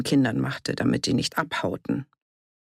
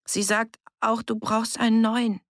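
put-on higher-pitched child reporting; child's voice; 7 semitones higher than usual. A narrator's reading. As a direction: pass on mother's message as the child. Child passes on mother's message.

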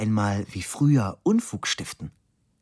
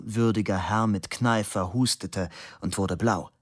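ordinary delivery

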